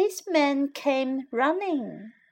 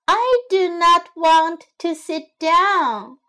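high, loud plea; old lady begging the policeman to stop; pleading; 6 semitones higher, 6 dB louder than usual. Acted. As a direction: beg him to stop, loudly. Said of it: "cried the old lady".

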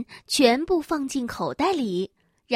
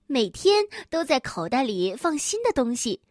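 ordinary delivery, nothing marked

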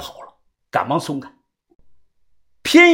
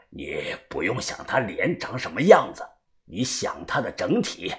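low, quiet instructing elder; old fortune-teller; 9 semitones lower, 9 dB quieter than usual. Acted. Old diviner instructs the narrator before divining, old fortune-teller. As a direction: guide them softly in an old man's low voice.

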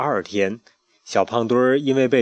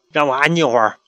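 impatient; 3 semitones higher, 4 dB louder, faster than usual. Acted. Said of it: "小胖墩儿不耐烦地回答".

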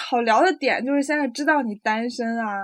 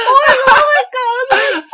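high loud fast wail of embarrassment; 11 semitones higher, 8 dB louder, much faster than usual. Real, not acted. Wailing at own blunder.

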